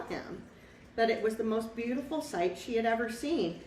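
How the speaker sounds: put-on lively read-aloud for a child; picture-book narrator voice; storybook narration. A narrator's reading.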